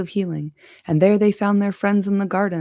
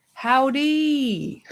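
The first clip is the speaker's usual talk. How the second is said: howdy; 7 semitones higher than usual. Acted. Playful greeting to listeners.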